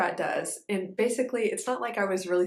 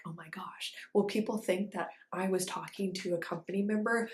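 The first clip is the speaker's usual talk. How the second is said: anxious whispered inner thought, much softer; 5 dB quieter than usual. Retold or imitated. Fearing she'll be caught talking to a company member, whispering. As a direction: whisper your worry.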